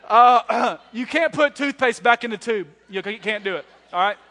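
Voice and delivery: ordinary delivery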